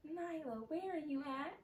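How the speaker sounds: high, hushed imitation of mom; imitated mom. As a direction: lightly voice a mother checking up. Voicing mom on the phone.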